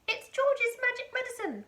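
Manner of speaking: put-on high, loud old woman's shout; Grandma shouting. A narrator's reading. Grandma shouting down from the roof to Mary. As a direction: call it out proudly. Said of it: "Grandma shouted".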